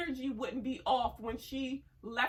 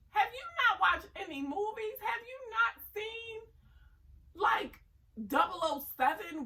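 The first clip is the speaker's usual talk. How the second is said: much higher, louder disbelief; exasperated scolding; 10 semitones higher, 4 dB louder than usual. Real, not acted. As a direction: shake her by the shoulders with words. Scolding Yolanda's naivety.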